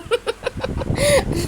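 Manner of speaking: a high, choked little laugh; nervous laugh. Real, not acted. Mother to her viewers, a high choked laugh. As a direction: laugh to cover rising tears. Emotional moment, about to open up.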